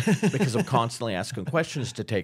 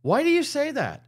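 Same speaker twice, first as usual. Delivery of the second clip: high, loud mock bewilderment; baffled protest; 6 dB louder than usual. Husband questioning his accusing wife, mock baffled. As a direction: play the husband pleading innocence, pitched way up.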